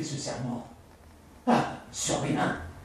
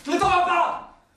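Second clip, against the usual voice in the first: much higher, louder plea; desperate cry; 7 dB louder than usual. Acted. She cries out for him not to leave, high and loud. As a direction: scream to stop him leaving.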